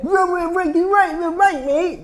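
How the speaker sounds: high, slurred imitation of thick Scouse; mock Liverpool accent. Liverpool fan talking at a baffled foreigner, mock thick accent. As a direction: garble it like an incomprehensible Liverpool fan. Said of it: "Terus dia ngomongin".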